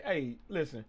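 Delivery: ordinary talk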